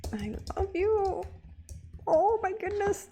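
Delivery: high, squealing gush of love; very high thrilled squeal; gushing delight. Real, not acted. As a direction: squeal it with joy. Thrilled by Tina's post.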